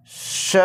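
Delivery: श given alone as a hushing sh syllable. Shown as a demonstration. Teacher listing three sounds of S, श as sh. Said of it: "इसका तीन pronunciation".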